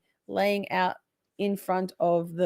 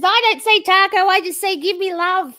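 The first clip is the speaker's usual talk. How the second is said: high squeaky reading; 10 semitones higher, 6 dB louder than usual. Retold or imitated. Streamer reading chat in a high squeaky voice. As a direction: voice jumps high and loud for a funny chat message. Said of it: "Poppy says".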